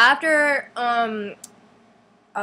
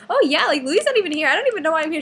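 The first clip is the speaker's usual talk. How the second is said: high-pitched, chirpy imitated chatter; chatterbox girl; 8 semitones higher, 4 dB louder than usual. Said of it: "such a chatterbox".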